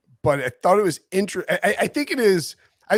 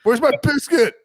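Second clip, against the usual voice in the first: put-on high, louder kid calling for mom; mock child calling out for mom, pitched high; whiny kid voice; 6 semitones higher, 8 dB louder than usual. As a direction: play a kid whining to his mom. Acting out a child's voice.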